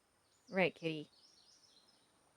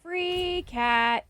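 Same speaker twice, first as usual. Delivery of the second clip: high and loud with glee; excited; 8 semitones higher, 8 dB louder, slower than usual. Real, not acted. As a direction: cheer about the free cat. Excited about free cat.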